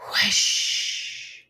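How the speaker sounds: breathy whooshing wind sound. Acted. Narrator imitating winter wind whoosh. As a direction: blow it out like a cold gust.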